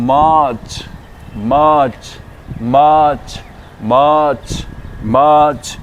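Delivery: मार्च as maach, long aa, falling; drilled word. Shown as a demonstration. Teacher models मार्च for learners, clear drill. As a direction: stretch the aa of मार्च. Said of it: "next word है".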